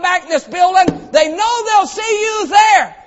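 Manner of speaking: shouted, high preaching climb; preaching at full force. Real, not acted. Pastor preaching to the congregation, shouting. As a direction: preach it out, rising to a slow shout.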